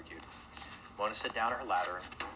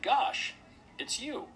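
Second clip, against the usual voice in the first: high-pitched waking exclamation; sleepy bear; 4 dB louder, much slower than usual. A narrator's reading. Sleepy Brumble recognises Mona, high waking voice. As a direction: come to with a high-pitched start. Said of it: "looked at her sleepily and said".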